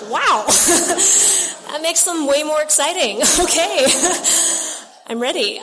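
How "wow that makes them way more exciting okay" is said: loud, higher laughing through the words; real laugh breaking up the line. Real, not acted. Speaker laughs to the meeting at her own story.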